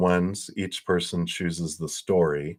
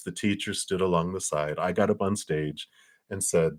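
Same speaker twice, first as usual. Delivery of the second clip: ordinary talk, nothing marked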